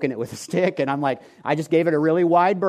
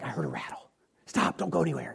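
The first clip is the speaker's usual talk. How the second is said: hushed warning; 8 dB quieter than usual. Retold or imitated. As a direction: keep it down so the snake won't hear. Husband hushed warning on trail.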